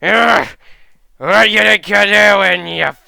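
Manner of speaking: growing annoyance shouted in character; unhappy boar fighter. Acted. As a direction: roar at an intruder who spoiled your victory. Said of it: "is not happy having someone just show up".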